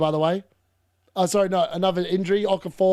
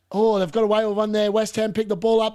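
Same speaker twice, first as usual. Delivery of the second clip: normal talking voice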